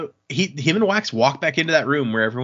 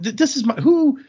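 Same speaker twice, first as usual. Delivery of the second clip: jumps much higher in disbelief; startled outrage; 13 semitones higher, 5 dB louder than usual. Retold or imitated. Entrone thrown when Marsh ignores him, higher and startled. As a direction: sputter it out, caught off guard, pitch climbing. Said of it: "And Entrone is just like".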